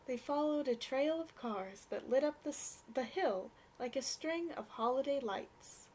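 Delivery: ordinary delivery, nothing marked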